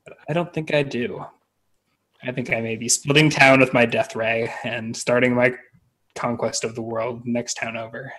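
usual speaking voice

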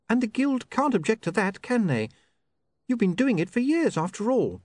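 put-on high, sharp young woman's voice; an offended, prim young lady; indignant young woman. Sacharissa prim.

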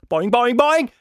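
high, loud cartoon boings; springy boing noise. A narrator's reading. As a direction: bounce it out like a spring. Imitated noise of bike bouncing. (hohes, lautes Sprungfeder-Boing)

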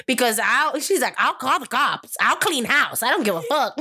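high, heated mock outburst of a fed-up mom. Quoting his fed-up mom, heated and high. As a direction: throw the threats out sharp and high.

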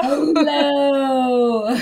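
mock hello.

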